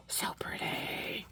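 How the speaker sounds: slow, hushed whisper of awe; whispered admiration over the beads; awed whisper. Real, not acted. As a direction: breathe it out in wonder. Admiring beads after a gasp.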